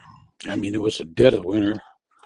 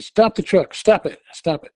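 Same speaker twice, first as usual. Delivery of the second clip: urgent command; 8 semitones higher than usual. Retold or imitated. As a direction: stop him now. Ordering driver to stop.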